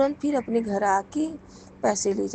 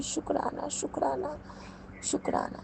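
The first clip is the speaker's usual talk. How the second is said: hushed thanks; 5 semitones higher, 8 dB quieter than usual. Real, not acted. Whispered thanks to guru.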